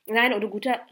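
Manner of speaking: loud, emotional protest; moved cry. A narrator's reading. Notara reassuring his distressed friend, moved cry. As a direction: break in warmly and forcefully. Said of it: "rief Notara bewegt".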